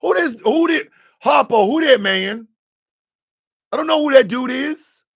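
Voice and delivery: high, loud, put-on bewildered voice; mock bewildered stranger. Mimicking people who don't know him, bewildered and loud. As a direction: play a baffled stranger who has never seen him. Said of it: "people describe a motherfucker".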